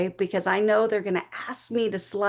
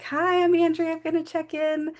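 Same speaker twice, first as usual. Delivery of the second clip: high, tight, fake-bright check-in voice; nervous younger self; 7 semitones higher than usual. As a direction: chirp it out, trying to sound fine while panicking. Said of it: "I'm like".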